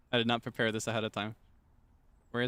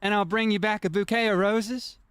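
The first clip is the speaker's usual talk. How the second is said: mock Southern-tinged accent; 6 semitones higher, 5 dB louder than usual. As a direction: promise roses like a Southern belle in an old film. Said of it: "it's almost Southern".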